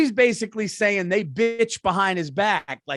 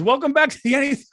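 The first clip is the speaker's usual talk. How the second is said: fast, higher podcast intro broken by a laugh; laughing through pain; 6 semitones higher, much faster than usual. Pretend. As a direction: rush the intro and crack up. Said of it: "imagine I did the podcast".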